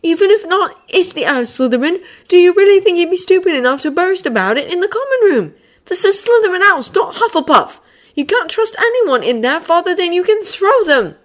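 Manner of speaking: high, loud exasperated lecture. Acted. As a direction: lecture them, fed up.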